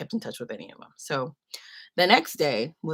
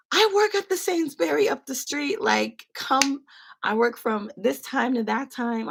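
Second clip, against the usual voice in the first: much higher, calling out kindly; eager old lady; 8 semitones higher, slower than usual. Retold or imitated. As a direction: insist warmly like a helpful auntie. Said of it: "She's like".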